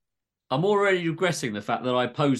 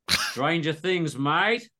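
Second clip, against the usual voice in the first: slow, dry Aussie doc; unimpressed Aussie doctor to patient; exaggerated Aussie accent; 4 semitones higher, much slower than usual. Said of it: "all dialogue is in exaggerated Aussie accents".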